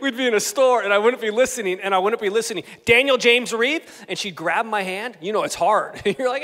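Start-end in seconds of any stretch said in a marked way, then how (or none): 2.8-3.8 loud mock full-name reprimand; sharp parental warning, acted out; scolding mom. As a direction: imitate mom calling out a naughty kid. Mom scolding child in store.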